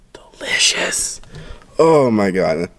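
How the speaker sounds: ordinary talk, nothing marked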